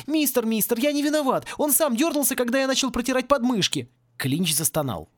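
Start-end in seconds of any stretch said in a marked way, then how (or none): 0.1-3.9 put-on high, pleading boy's voice; babbling excuses. A narrator's reading. Scolded boy to caretaker, frightened babbling. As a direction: plead innocence in a flustered whine. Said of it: "пролепетал Порри".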